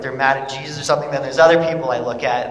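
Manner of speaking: usual speaking voice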